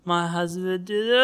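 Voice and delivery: imitated wife breaking into a loud wail; mock crying. Wife telling people about her husband, mock crying. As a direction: start slow, then burst into hammed-up sobs. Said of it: "and then she starts crying".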